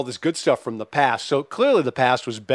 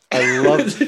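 normal talking voice

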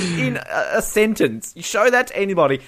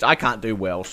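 ordinary talk, nothing marked

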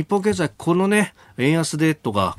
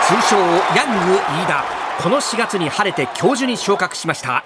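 sports commentator; 8 semitones higher than usual. Acted. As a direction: hype play-by-play voice.

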